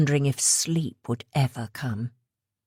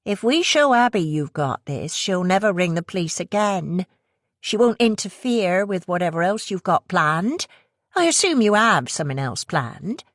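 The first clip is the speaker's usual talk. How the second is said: coaxing West Country woman; 3 semitones higher than usual. Acted. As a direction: tempt her in a West Country accent. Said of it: "the accent heavy West Country".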